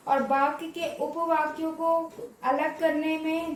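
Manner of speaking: usual speaking voice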